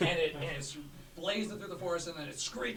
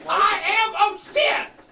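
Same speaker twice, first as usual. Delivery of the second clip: slow, loud, higher I am upset; mock upset; 16 dB louder, much slower than usual. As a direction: stretch out the protest. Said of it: "You seem very upset".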